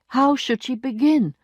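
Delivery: plain reading voice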